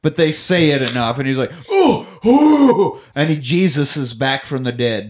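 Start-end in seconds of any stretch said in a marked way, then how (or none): 1.7-3.0 high, loud "oh, oh"; mock revival gasp. Santa back from the dead, high imitated gasp. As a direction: burst back to life with whoops.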